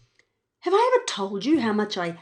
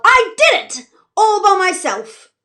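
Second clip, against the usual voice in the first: much higher, louder triumphant yell; 10 semitones higher, 9 dB louder than usual. Acted. Evie yelling to Jack, proud and loud. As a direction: shout it out proud. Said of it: "I yawn and yell".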